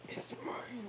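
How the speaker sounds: soft, high imitation whisper; whispering kids. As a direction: whisper like squabbling brothers. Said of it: "they're whispering to each other".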